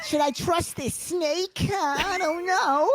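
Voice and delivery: high, slow hammed-up boy voice; doubtful Mowgli. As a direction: wonder aloud in a kid's voice. Voicing Mowgli doubting snake.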